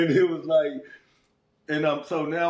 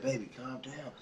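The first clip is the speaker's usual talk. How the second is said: much softer, calming murmur; soothing; 4 semitones lower, 12 dB quieter than usual. Retold or imitated. Girlfriend calming him as he gets worked up, much softer. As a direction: settle him down gently.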